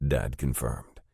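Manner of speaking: ordinary delivery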